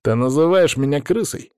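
indignant outraged question. A narrator's reading. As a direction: bristle at the insult. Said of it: "возмутился Павел".

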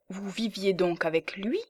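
ordinary delivery, nothing marked

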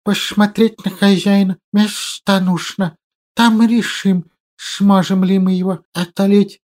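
whistling hiss like a kettle. Acted. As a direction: lisp with a whistle on each s. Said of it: "как он умудряется даже мысленно шепелявить".

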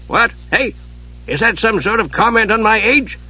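put-on high-pitched crotchety old man; indignant old wizard. A narrator's reading. Old wizard answers a greeting, crotchety indignation. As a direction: take offence in an elderly voice. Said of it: "The wizard glared".

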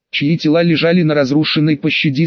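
plain reading voice

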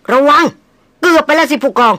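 high, loud startled warning; alarmed cry. A narrator's reading. Friend nearly falls toward the beast, alarmed cry. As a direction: shout the warning in fright. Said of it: "เชษฐาร้องอย่างใจหายใจคว่ำ".

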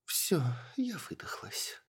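exhausted, barely voiced whisper; weak whisper. A narrator's reading. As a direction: breathe it out, spent and drained. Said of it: "слабым голосом протянула эльфийка". (обессиленный шёпот)

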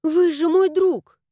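emphatic, affectionate exclamation; sincere assurance. A narrator's reading. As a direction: insist with full sincerity. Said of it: "искренне заверила я".

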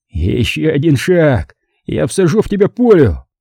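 Larry threatens to shoot the narrator, hissed in anger; angry hiss. A narrator's reading. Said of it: "злобно прошипел он".

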